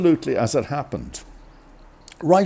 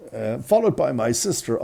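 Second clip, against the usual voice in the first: ordinary delivery, nothing marked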